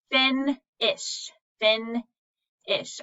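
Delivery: fin-ish, pause between the beats; split into syllables. Shown as a demonstration. Teacher breaking finish into syllables for the class. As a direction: chop finish into two parts. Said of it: "let's take a look at finish".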